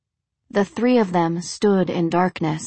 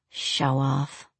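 low, soft grumble; muttered; 4 semitones lower, 6 dB quieter than usual. A narrator's reading. Drained woman grumbles about the blonde, muttering. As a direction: mutter it under your breath. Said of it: "she muttered".

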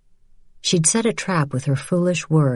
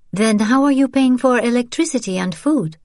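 put-on young woman voice; 8 semitones higher than usual. Isabelle voiced.

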